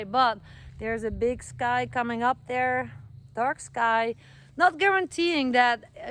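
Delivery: usual speaking voice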